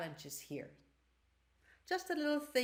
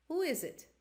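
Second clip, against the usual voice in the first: higher, lightly voiced gentle woman asking; elderly lady asks through the door, gently and a bit higher; gentle female voice; 6 semitones higher, faster than usual. A narrator's reading. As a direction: ask it like a kind lady behind the door. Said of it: "Says a gentle female voice".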